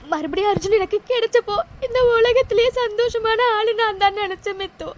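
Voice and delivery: broken, tearful voice catching on words; sobbing. Acted. Heartbroken girl sobbing to her friend, in despair. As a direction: cry it out in despair.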